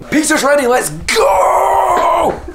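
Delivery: excited shout. Real, not acted. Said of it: "Excited, [name]".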